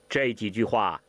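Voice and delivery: ordinary delivery, nothing marked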